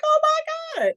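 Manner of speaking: put-on high, thrilled little-kid squeal; wide-eyed childish awe; excited child gasp. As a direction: gasp it out like a four-year-old seeing heaven. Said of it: "And I was like".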